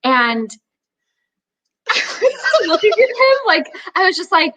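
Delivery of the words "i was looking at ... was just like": ordinary talk, nothing marked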